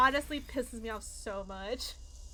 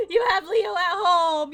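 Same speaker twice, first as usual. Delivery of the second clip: louder, higher, pleading insistence; upset outburst; 5 semitones higher, 9 dB louder than usual. Real, not acted. Streamer arguing with Shoma about his dog, upset outburst. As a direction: protest it loudly, exasperated.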